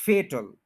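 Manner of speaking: फेटल with long "ay", as FAY-tl; correct fatal. Shown as a demonstration. Teacher giving the correct word, फेटल as FAY-tl. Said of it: "फैटल गलत है".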